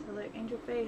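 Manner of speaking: normal talking voice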